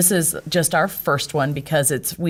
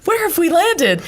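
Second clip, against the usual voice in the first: shocked disbelief; 14 semitones higher than usual. Retold or imitated. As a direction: blurt it out in total disbelief. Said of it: "And I was like".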